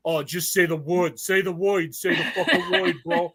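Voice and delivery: slow, itching-to-fight mock tough guy; Jersey guys itching for a fight, hammed-up and laughing; eager Jersey thugs. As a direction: egg him on like a hothead, laughing. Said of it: "All these Jersey guys are all like".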